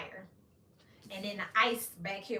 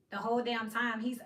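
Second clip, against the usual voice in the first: normal talking voice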